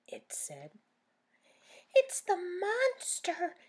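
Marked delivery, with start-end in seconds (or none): 1.9-3.6 high, loud frightened cry; scared Daisy. Acted. Daisy alarmed by the noise from the shed, loud. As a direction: gasp it out in alarm. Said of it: "said Daisy".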